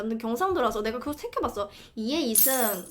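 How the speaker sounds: ordinary talk, nothing marked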